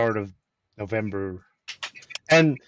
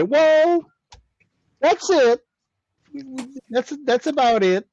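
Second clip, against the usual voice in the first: high, loud mock shrug; dismissive customer service voice; 12 semitones higher, 4 dB louder than usual. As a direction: imitate the company brushing him off. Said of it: "and they say".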